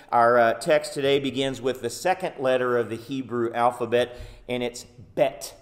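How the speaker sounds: usual speaking voice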